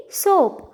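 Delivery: "soap" with a long O, pitched high then falling; long vowel. Shown as a demonstration. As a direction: draw out the O in "soap". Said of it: "we will see now long vowel sound". ("soap" में लंबा ओ)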